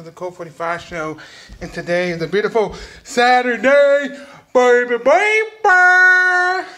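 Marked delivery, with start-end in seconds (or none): 1.6-6.7 loud, high stretched-out hype; hyped intro. Real, not acted. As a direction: belt the intro like a hype man. Hyped vlog intro shout.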